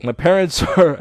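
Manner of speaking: put-on booming Arnold Schwarzenegger accent; loud, heavy Austrian Arnold accent. Narrator slipping into Arnold, loud Austrian accent. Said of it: "I just went Arnold right there".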